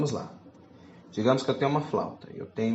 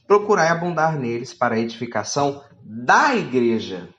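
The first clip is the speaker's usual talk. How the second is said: louder, declaiming Bible reading; scripture reading; 5 dB louder than usual. Acted. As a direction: proclaim the verse to the church. Reads scripture aloud.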